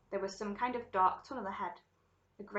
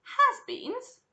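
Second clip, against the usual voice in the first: very high, loud echo; incredulous cry; 10 dB louder than usual. A narrator's reading. Violet cries out at the sign on the door, incredulous. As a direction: exclaim it in disbelief. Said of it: "cried Vi-, Violet Beauregarde".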